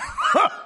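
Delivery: short high scornful laugh; a man's derisive guffaw; mocking burst of laughter. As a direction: laugh at the women's worry. Sheriff throws up his hands.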